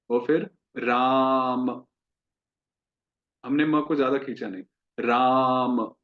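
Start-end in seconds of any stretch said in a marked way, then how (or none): 0.8-1.9 राम with aa drawn out slowly; long राम. Shown as a demonstration. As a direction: stretch राम's aa, short m. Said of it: "बड़ा अक्षर है तो हम उसको खींच रहे हैं".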